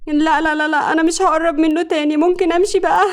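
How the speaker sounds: high-pitched anxious plea; frightened pleading. A narrator's reading. Cowed girl promising to stay away from him, frightened. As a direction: beg to be let go. Beaten girl begging to leave.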